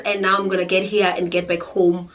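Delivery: ordinary talk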